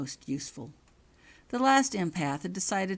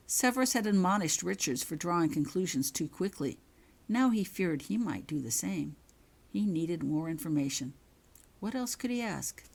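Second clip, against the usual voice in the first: plain reading voice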